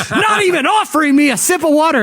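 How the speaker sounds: high aggrieved complaint; aggrieved actor. Retold or imitated. Actor complaining to the panel, high pitched. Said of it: "he's like".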